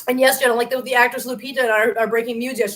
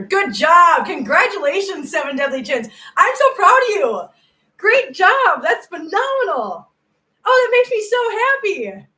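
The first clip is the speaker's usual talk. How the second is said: much higher, loud gushing congratulations; overjoyed praise; 8 semitones higher, 5 dB louder than usual. Real, not acted. Praising a viewer six years sober, gushing joy. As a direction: burst with pride for them.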